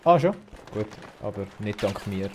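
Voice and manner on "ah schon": surprised. Real, not acted. Surprised reaction in game.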